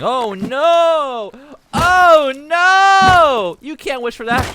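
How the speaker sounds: high, very loud drawn-out wail; panicked cartoon scream. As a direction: scream the protest as a horrified little character. Acts Stuart Little in panic.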